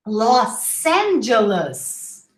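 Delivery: Los Angeles slowly with a long S; Los Angeles as losss-AN-juh-luss; double S. Shown as a demonstration. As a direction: hold the S between Los and Angeles. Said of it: "pronounce double S instead of a Z".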